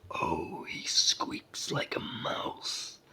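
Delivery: big, slow giant voice, amused; loud and drawn out, like a huge creature; thundering giant. A narrator's reading. Giant amused by his tiny captive, booming slowly. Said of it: "in a voice like very near thunder".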